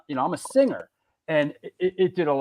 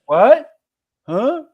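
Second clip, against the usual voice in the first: loud, high confused "what, huh"; playing deaf; 9 semitones higher, 11 dB louder than usual. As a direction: act like you can't hear.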